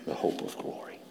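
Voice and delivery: breathy whisper of scripture. Acted. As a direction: hush it like a secret.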